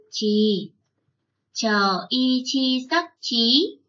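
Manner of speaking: slow chant: Chờ y chi, then the tone and the word; slow spelling drill. Shown as a demonstration. Teacher chanting slowly so the class reads along. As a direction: spell each tone slowly for pupils to copy. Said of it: "Chúng mình cùng đọc với cô".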